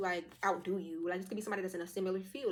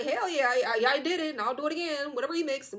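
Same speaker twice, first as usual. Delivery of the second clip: loud, higher, brazen owning-up; defiant bragging; proud confession; 7 semitones higher, 9 dB louder than usual. Acted. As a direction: boast of the deed with relish.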